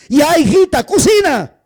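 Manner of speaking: loud, high parental order; voice of command. Retold or imitated. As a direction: bark the order at the daughter like a mother. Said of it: "Voz de mando". (voz de mando fuerte y aguda)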